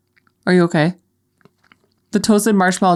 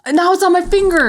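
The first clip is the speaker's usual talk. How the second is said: pitched-up horror; high horrified; 12 semitones higher than usual. Real, not acted. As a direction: shriek it out in disgust. Horrified by the smell on her hands.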